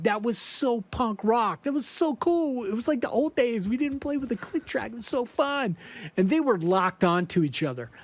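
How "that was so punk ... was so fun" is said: high, mock-thrilled gushing; raving about the old days; gushing bandmates. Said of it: "they're just like".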